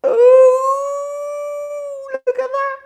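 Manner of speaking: long high loud ooh; high cooing admiration. Real, not acted. Host shows viewers his gin sour, high admiring coo. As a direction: gush over it, high and drawn out.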